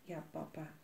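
put-on children quietly answering their angry dad; softly. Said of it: "zeiden ze zachtjes".

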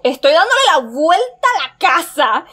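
high, loud, swooping voice; loud wail with leaping pitch; panicked exclamation. Real, not acted. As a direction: cry it out in exasperated panic. Panicking, circling the house.

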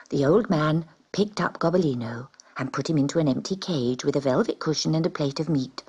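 plain reading voice

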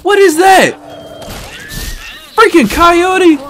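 high, loud startled yell at a sudden animal; startled shout. Real, not acted. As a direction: yelp it out in alarm. Startled by a coyote attack.

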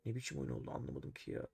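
whispered to himself, puzzled; hushed, confused aside to himself; baffled whisper. Real, not acted. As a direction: mutter it under your breath in disbelief. Baffled by opponent's chess move.

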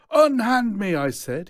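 high, loud indignant cry; a loud, high protest. Acted. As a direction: cry it out in outrage. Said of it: "he cries".

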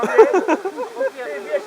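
high, excited gossip voice; high gossipy squeal. Acted. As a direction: shriek it like a thrilled gossip. Gossiping woman on phone.

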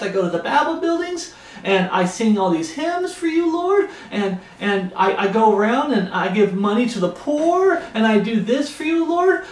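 high, whiny mock bragging with a laugh; mocking false convert. Preacher mocking false converts showing off their Sunday best, high whiny brag. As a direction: brag up high like a smug churchgoer. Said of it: "The false converts out there".

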